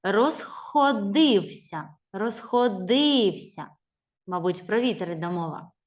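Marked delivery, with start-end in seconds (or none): 0.0-3.7 Розходився said slowly with the stressed syllable held; stress shown. Shown as a demonstration. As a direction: drag Розходився with clear stress. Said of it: "в слові стоїть наголос".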